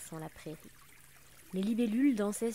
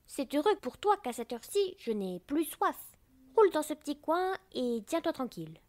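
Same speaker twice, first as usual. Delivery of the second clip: higher-pitched scolding flower; haughty anemone; 7 semitones higher, 4 dB louder than usual. A narrator's reading. Anemone letting the drop stay in a corner, scolding. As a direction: tell off an uninvited little guest.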